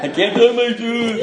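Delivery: put-on high, slow, wailing drunk whine; comic high weepy whine; mock drunk crying. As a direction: whimper it like a weepy drunk kid. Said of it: "I was crying on the doorstep".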